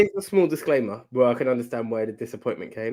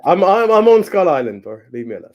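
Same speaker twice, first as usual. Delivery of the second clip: louder, higher playful protest; mock defensive; 5 semitones higher, 11 dB louder than usual. Real, not acted. Teased by co-host, mock defensive outburst. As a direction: shoo him off laughing.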